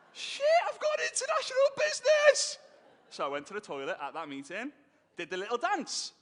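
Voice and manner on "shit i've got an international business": much higher and louder, a whoop of joy; elated shout. Retold or imitated. Celebrating his new international business, shouting for joy. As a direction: shout it out like you just struck gold. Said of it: "thrilled at the news".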